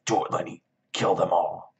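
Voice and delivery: mock-demonic urging voice; sinister rabbit. Imagined rabbit urges Lenny to kill, in a mock sinister voice. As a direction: egg him on like an imaginary evil creature.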